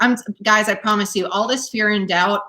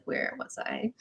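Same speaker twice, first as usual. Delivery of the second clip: whispered; 4 semitones higher, 15 dB quieter, slower than usual. Retold or imitated. Retells losing track, own inner voice.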